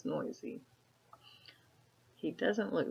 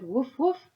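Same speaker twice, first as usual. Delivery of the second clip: dog bark; 7 semitones higher, 6 dB louder than usual. Acted. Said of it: "No dog said".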